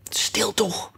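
urgent whispered hush; soft and hasty. A narrator's reading. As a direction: shush in an anxious whisper. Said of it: "zei het konijn zachtjes en haastig". (zachtjes en haastig gefluisterd)

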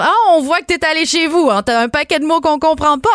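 lively, high put-on reaction; imitated French friends. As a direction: play a French friend teasing her about her speech. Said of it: "ils vont dire".

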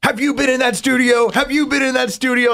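high, frantic repeated question; mock panic from a boss pointing at him; panicked boss. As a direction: imitate the boss losing his mind. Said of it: "pointing at me going".

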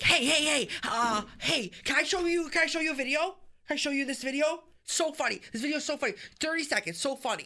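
pestering you to watch his funny clip; mock excited coworker. Excited coworker voiced in story.

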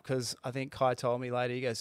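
ordinary talk

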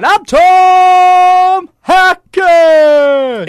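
huge, slow, high arena announcer; monster truck announcer. Shown as a demonstration. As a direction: stretch every word like a rally announcer. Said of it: "announcer at a monster truck rally".

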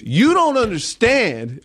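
high, drawn-out imitation of exasperation; exasperated cousin. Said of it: "that's exactly what he said".

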